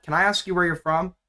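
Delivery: ordinary talk, nothing marked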